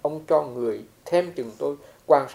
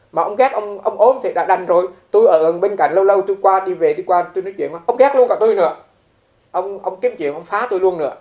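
higher, much louder, worked-up telling; agitated retold grievance; 5 semitones higher, 10 dB louder than usual. Teacher retells the monk's grievance about a hostile abbot, agitated. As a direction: voice raised over being hated too.

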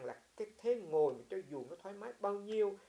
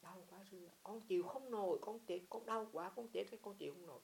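suffering patient; 5 semitones higher than usual. Retold or imitated. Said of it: "Biết tại sao mà chết?".

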